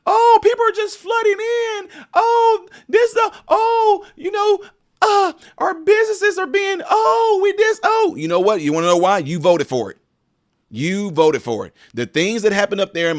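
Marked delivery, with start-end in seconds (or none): 0.0-8.2 much higher, fake sobbing whine about the borders; mock crying complainer. Mocking voters who complain about borders, in a hammed-up crying whine. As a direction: wail it like a crybaby, slow and teary. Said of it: "If you are complaining right now".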